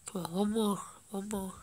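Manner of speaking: ordinary talk, nothing marked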